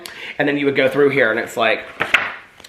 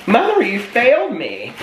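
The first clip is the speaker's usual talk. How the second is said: mock dramatic accusation; 8 semitones higher than usual. Mock complaint to absent mother.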